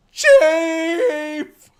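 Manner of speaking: very high, loud, long-held wail; hammed-up drawn-out shriek; anguished scream. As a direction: scream out the name in horror. Acted scream on finding the chief.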